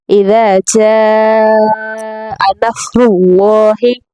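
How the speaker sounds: slow melodic Quran recital; slow recitation with a rising end; tajweed recitation. Shown as a demonstration. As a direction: chant the verse slowly and evenly.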